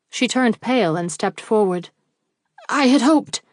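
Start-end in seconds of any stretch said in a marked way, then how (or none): 2.7-3.4 a broken start, pitched up in distress; stammering, higher and louder. A narrator's reading. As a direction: falter on the words, higher. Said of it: "she stammered".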